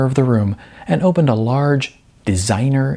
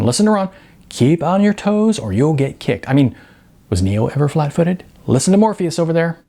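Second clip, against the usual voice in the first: eager Sean; 6 semitones higher than usual. A narrator's reading. Sean voiced high.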